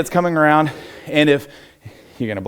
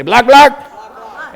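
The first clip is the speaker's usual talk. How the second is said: louder, higher drill call for the class; 8 semitones higher, 8 dB louder than usual. Shown as a demonstration. Coach calling the drill for students, loud and high. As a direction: shout the cue so the room echoes it.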